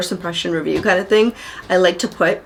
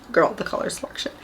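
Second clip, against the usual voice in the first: whispered emphasis; 8 dB quieter than usual. Real, not acted. Repeats phrase for emphasis.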